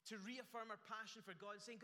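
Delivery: ordinary delivery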